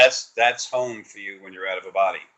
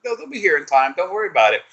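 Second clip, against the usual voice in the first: voicing his energy; 9 semitones higher, faster than usual. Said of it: "And he goes".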